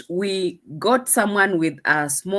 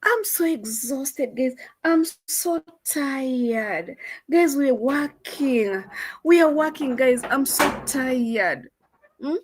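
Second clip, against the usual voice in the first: higher, slow, whiny mock of an exhausted girl; mocking tired vlogger; 6 semitones higher, slower than usual.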